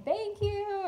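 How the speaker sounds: screaming thanks. Retold or imitated. Shoppers thanking the giver, a high happy squeal. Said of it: "and kind of screaming".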